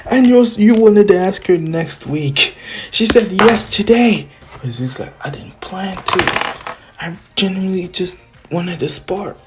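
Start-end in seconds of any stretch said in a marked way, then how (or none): 0.1-4.3 loud, high teasing voice; hammed-up excited taunt, pitched up; gleeful crowing. Inner voice teasing Izuku about the date, gleeful. 5.2-9.3 mild whiny protest. Retold or imitated. Izuku protesting he only wanted sparring, plaintive.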